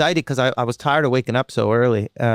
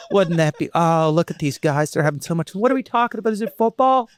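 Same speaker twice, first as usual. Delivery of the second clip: pathetic outsider; 8 semitones higher than usual. Retold or imitated. Mock lonely guy peeking in.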